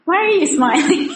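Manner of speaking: asked through a laugh, high and amused; laughing question. Real, not acted. To a smiling woman in the audience, laughing. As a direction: tease her while laughing.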